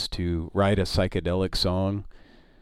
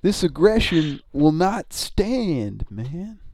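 slow, higher grave presidential voice; George Bush impression; 7 semitones higher, much slower than usual. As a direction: declare it slowly and gravely. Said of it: "Repeating George Bush".

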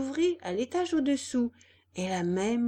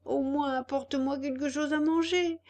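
high, louder whiny puppet voice; complaining puppet; 6 semitones higher, 3 dB louder than usual. Acted. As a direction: protest in a high wooden-boy voice. Hungry puppet begs.

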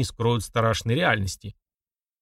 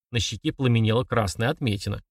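usual speaking voice

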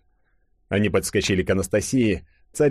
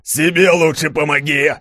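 loud, higher angry bellow; roared retort; 8 semitones higher, 7 dB louder than usual. A narrator's reading. Gromov cuts Kolchak off and shoots, roaring. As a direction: roar it as you fire. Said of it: "взревел Громов".

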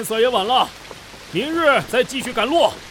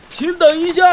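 raised voice calling to someone ahead; 8 semitones higher, 7 dB louder than usual. Acted. Villager calls out to the young gentleman, loudly. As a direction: call out to stop him. Calling after a stranger on road.

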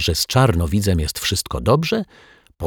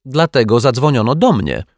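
higher and louder, called down; shouting back; 7 semitones higher, 5 dB louder than usual. A narrator's reading. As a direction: yell it back from the window. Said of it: "odkrzyknęła pani Ryczaj".

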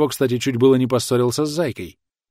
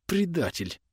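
soft, higher-pitched angry hiss; hissed through teeth; 6 semitones higher, 7 dB quieter, slower than usual. A narrator's reading. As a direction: hiss the accusation under your breath. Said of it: "прошипела она сквозь зубы".

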